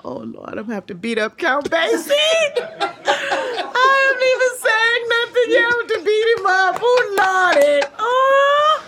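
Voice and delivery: high, slow, laughing lament; half-crying, half-laughing; laughing panic. Retold or imitated. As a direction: wail through laughter at the absurd fear. Laughing panic about beating [name].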